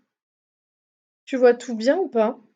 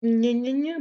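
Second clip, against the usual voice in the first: mocking; slower than usual. Mocks the text being read.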